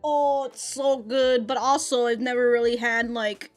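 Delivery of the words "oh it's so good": usual speaking voice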